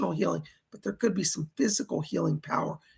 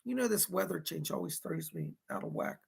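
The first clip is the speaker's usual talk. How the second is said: much softer, almost under the breath; lowered voice; 10 dB quieter than usual. Real, not acted. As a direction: drop the voice way down, close to the mic. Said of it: "Lowers my voice here".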